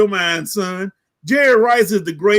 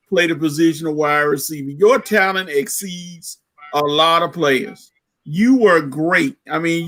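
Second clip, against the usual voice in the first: usual speaking voice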